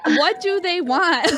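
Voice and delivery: laughing through a confused question, slowly. Retold or imitated. Retelling grandma's phone call, laughing. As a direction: giggle out the puzzled question.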